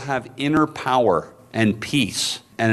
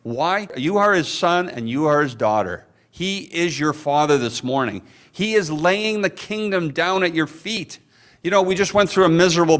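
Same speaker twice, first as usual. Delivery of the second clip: preaching; 7 semitones higher than usual. Acted. Preacher building an opening declaration.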